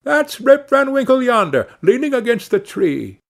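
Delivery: high, eager bystander voice; exclaiming. A narrator's reading. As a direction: point someone out in a high-pitched exclamation. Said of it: "exclaimed two or three".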